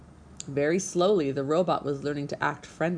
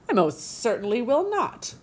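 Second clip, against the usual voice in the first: high, indignant refusal; squawking goose; 6 semitones higher, 4 dB louder than usual. A narrator's reading. Goose refusing to take the orphan gosling, indignant squawk. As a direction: squawk it back, affronted. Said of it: "squawked the goose".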